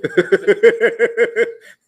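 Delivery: loud, high burst of laughter; big laugh. Real, not acted. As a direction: laugh hard, in a long rapid run. Laughing at the kitchen joke.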